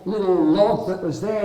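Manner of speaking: ordinary delivery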